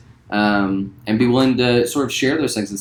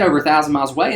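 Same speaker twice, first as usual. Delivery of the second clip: normal talking voice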